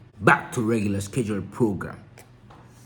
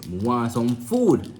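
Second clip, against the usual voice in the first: slow, louder Creole line; Jamaican Creole; 3 semitones higher, 5 dB louder, slower than usual. Shown as a demonstration. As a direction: say it the island way. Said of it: "No, I say".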